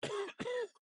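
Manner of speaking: a pained, rasping cough; weak cough. Acted. The dying knight interrupts the young medic, weak pained cough. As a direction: cough as if the body is failing.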